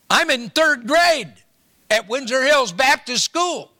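schoolboy telling the pastor who he is, high and eager; young boy voice. Retold or imitated. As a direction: pitch it up like a third grader answering. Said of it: "this young boy's voice".

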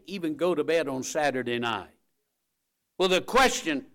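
ordinary talk, nothing marked

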